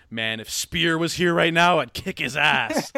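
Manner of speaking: slow, loud mock tough-guy boast; drunk tough-guy threat. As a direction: lean into a drunk bully bragging. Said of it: "and he goes".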